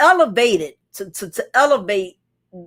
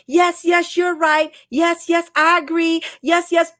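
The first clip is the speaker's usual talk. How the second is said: mock eager agreeing; 5 semitones higher than usual. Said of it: "when you have a female in your chat".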